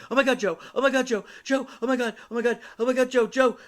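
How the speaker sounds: high, loud hysterical coordinator; mock top-of-the-lungs scream; imitated panicked screaming. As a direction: shriek it in bursts, over and over. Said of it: "screaming at the top of her lungs".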